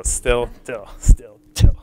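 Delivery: "still" with little puff, "till" with a long puff; aspiration contrast. Shown as a demonstration. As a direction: alternate "still" and "till", breath bursting only on "till". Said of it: "differ by aspiration".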